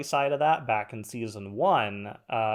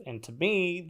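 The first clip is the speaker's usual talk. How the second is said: normal talking voice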